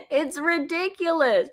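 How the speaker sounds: usual speaking voice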